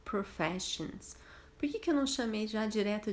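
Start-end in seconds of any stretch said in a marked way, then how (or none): 0.0-1.2 "Professions" said slowly and softly, pro-FESH-ons; slow model word. Shown as a demonstration. Teacher repeating the new word for students. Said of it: "também chamados de professions".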